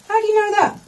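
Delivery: high challenging heckle; heckler voice. Pretend. Imagined heckler challenging the comic, high.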